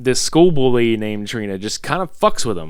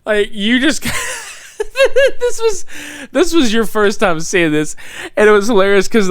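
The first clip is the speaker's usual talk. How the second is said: laughing, pitched far up and louder; 12 semitones higher, 5 dB louder than usual. Real, not acted. Reminding the co-host of his reaction, laughing hard. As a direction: talk through real laughter. Cracking up over the scene.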